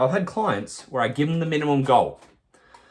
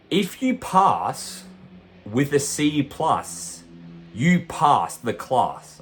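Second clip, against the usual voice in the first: slow, spaced, each phrase weighted; slow emphatic point; 3 semitones higher, much slower than usual. Real, not acted. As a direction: lay it out slowly like a verdict. Coach stressing key point.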